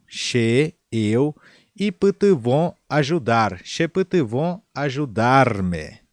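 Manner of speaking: normal talking voice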